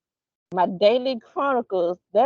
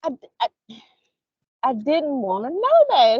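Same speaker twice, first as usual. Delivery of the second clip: mock disgust; 5 semitones higher than usual. To her son, a higher appalled mock recoil. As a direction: draw back, appalled. Mother recoils from son's remark.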